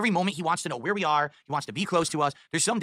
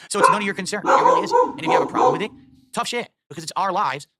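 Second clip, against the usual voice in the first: angry rant; 2 semitones higher, 6 dB louder than usual. Real, not acted. Streamer shouting down critics, fed up and loud. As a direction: tell the haters off, louder and louder.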